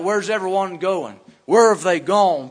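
loud, climactic preacher shout; hollering where have they gone; shouted question. Real, not acted. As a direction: cry it out to the whole church.

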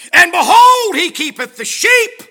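very loud, high, slow preacher's cry; preaching at full force. Acted. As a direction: stretch each word out to the church. Preached scripture and Jesse's excuse.

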